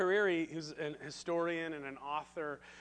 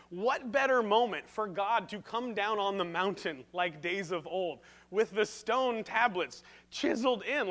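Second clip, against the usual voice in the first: ordinary delivery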